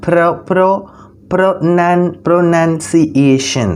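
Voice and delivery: "pronunciation" built up slowly piece by piece; syllable-by-syllable pronunciation. Shown as a demonstration. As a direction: sound out "pronunciation" in slow, separate syllables. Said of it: "ഞാനിങ്ങനെ ഉച്ചരിക്കുന്നില്ലേ?". ("pronunciation" ഓരോ അക്ഷരമായി പതുക്കെ ഉച്ചരിച്ച്)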